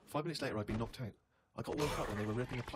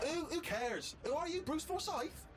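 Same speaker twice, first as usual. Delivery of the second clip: mock scornful girlfriend, much higher pitch; slower than usual. Girlfriend belittling a TV star, imitated with high-pitched scorn. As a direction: imitate her sneer, high and drawn out at the end. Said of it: "And she went".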